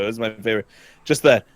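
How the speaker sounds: usual speaking voice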